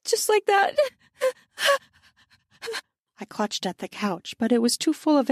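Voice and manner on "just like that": very high gasped words; gasping moan. Acted. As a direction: gasp it out between moans. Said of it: "I gasped as my moans increased".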